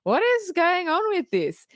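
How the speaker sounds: high, baffled question; confused. Retold or imitated. Host recalling the chaotic ending, high baffled question. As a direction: throw it up high in disbelief.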